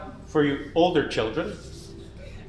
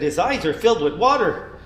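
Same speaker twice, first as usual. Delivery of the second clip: plain reading voice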